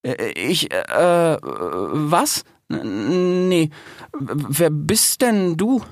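halting, nervous fox; flustered stammer. A narrator's reading. Fox taken aback by the hyena, bewildered stammering. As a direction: stretch and trip over each word.

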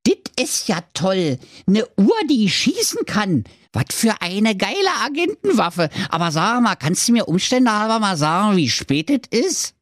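high squeaky dwarf, all thrilled. A narrator's reading. Dwarf Gernot gushes over the shooting watch, thrilled and high. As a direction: gush with Berlin-tinged glee. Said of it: "war ganz begeistert und rief".

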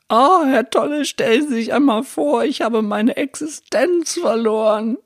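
a man wailing about his lost livelihood; desperate visitor. Retold or imitated. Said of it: "der hat gesagt". (verzweifelter Besucher, viel höher und klagend)

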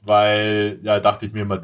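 normal talking voice

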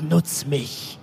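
low, slow, tempting plea; seductive whisper. Pretend. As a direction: beg to be played with, slowly. Objects personified, begging to be used.

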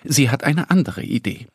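usual speaking voice

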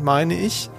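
ordinary talk

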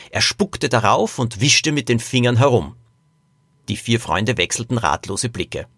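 usual speaking voice

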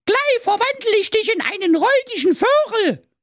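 very high, scolding fairy voice; evil fairy threatening. Acted. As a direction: threaten a spell in a high witchy pitch. (sehr hohe, drohende Feenstimme)

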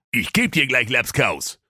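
mild mock bluster; king blustering. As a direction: grumble it like an angry king. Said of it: "polterte König Blumenkohl".

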